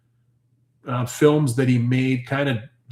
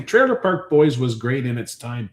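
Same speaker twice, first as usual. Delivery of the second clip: ordinary talk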